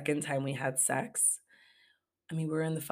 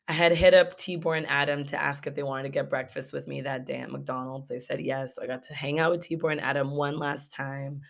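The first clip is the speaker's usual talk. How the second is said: usual speaking voice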